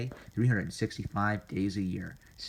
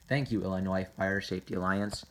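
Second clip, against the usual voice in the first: normal talking voice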